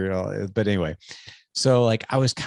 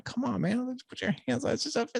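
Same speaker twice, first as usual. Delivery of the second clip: coaxing dad; faster than usual. Retold or imitated. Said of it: "I'm like".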